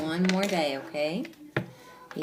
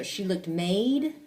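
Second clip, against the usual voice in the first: slow, with "made" stretched on a long A; deliberate misreading; 3 dB louder, much slower than usual. Shown as a demonstration. Teacher showing pupil it makes no sense, deliberate. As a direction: repeat the wrong word "made" slowly, questioningly. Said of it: "Didn't quite make sense".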